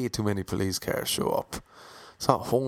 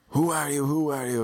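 higher, mildly pushed police demand; mock police shout; 5 semitones higher than usual. Police shouting at the man in the yellow hoodie, a mild mock shout. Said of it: "And they're all shouting".

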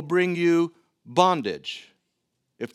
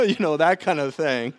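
laughing at himself. Real, not acted.

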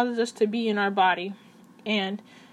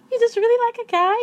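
put-on high, louder girly baby voice; 13 semitones higher, 6 dB louder than usual. Shown as a demonstration. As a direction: slide up into a cutesy baby voice. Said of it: "kinda talk in that baby voice".

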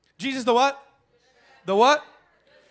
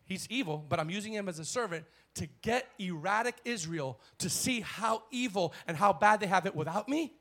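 ordinary delivery

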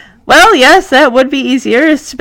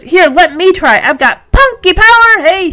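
put-on higher, punchy voice for a plucky kid; eager little girl; 7 semitones higher, 3 dB louder than usual. Punky offers to try the phone, eagerly. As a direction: pitch it up with can-do confidence.

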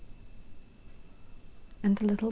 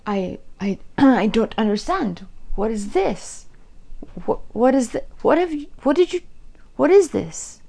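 1 bewildered; 2 semitones higher, 9 dB louder than usual. A narrator's reading. King opens box, bewildered.

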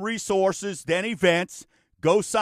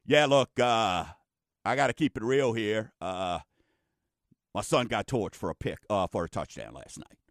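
much lower and softer, a dejected dad owning up; glum admission; 9 semitones lower, 4 dB quieter than usual. Pretend. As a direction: mumble it like a beaten father at the Saturday show. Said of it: "be like".

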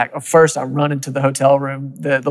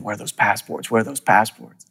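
anxious inner thought; 5 semitones lower, 6 dB quieter than usual. Retold or imitated. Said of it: "and I'm thinking".

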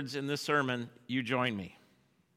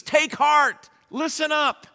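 higher, louder summons; rousing call; 9 semitones higher, 5 dB louder than usual. Acted. Jesus rousing the disciples, high and ringing. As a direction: call them to attention.

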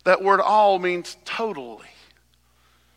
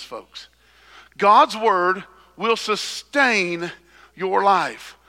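usual speaking voice

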